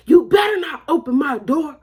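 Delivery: high, loud warning from an angry mother; scolding mama shout; mama yelling. Retold or imitated. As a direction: imitate mom shouting a threat.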